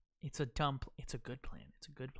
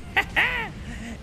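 high, loud cackle after a taunt; taunting laugh; 12 semitones higher, 5 dB louder than usual. Real, not acted. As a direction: cackle loudly at the enemy.

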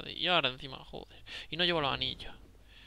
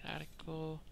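whispering; 10 dB quieter, much slower than usual. Real, not acted. Sorting gear, whispering to self.

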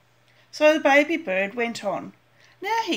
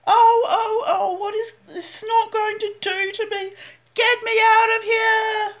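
frightened cry; 10 semitones higher, 6 dB louder than usual. Acted. Baby bird carried up by the snort, crying out in fright. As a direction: panic as he's carried off.

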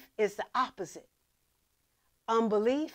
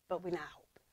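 whisper; 7 semitones lower, 9 dB quieter, faster than usual. Acted. Preacher drops voice on point.

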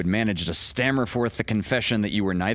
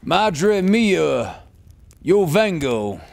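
calling out; 11 semitones higher, 6 dB louder, slower than usual. Acted. Son answers mother in Spanish.

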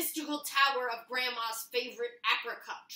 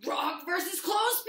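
higher, louder ring announcer with rising end; fight announcer; 7 semitones higher, 7 dB louder than usual. Acted. Announcing Rock versus Clothespin to the kids, fight-night hype. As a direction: hype the matchup.

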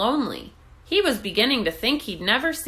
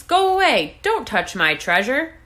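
high, loud angry lobster shout; growling lobster; 5 semitones higher, 5 dB louder than usual. A narrator's reading. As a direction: snap him away from the coins. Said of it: "the lobster growled".

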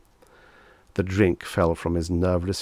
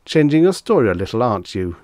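quick, higher, louder gotcha; triumphant; 4 semitones higher, 7 dB louder, faster than usual. A narrator's reading. Reggie catching out Death's agent, triumphant. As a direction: pounce on it triumphantly. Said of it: "Reggie said triumphantly".

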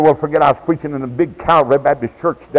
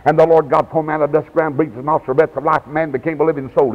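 verse rattled off fast; rapid recitation. Retold or imitated. Preacher quoting Genesis to a boy, rapid recitation. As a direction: quote the scripture in a quick run. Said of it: "Slow her down".

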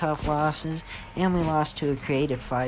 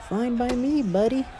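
higher, teasing jab at the opponent; cocky taunt; 8 semitones higher than usual. Real, not acted. Gamer taunting the fighter he's beating, cocky. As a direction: needle him like a smug rival.

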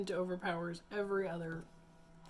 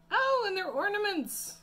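delighted; 13 semitones higher, 10 dB louder than usual. Real, not acted. Delighted discovery of ornament tea bags.

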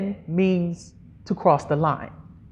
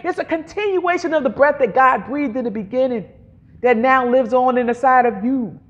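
ordinary talk, nothing marked

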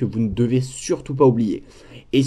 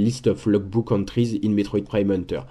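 English read with a heavy French accent; real French accent in English; bad English accent; 2 semitones lower than usual. Real, not acted. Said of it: "Excusez mon accent anglais totalement pourri".

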